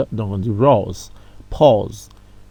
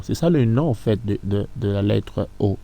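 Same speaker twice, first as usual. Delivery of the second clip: ordinary delivery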